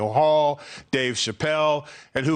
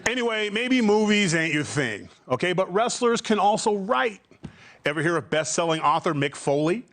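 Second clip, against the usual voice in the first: normal talking voice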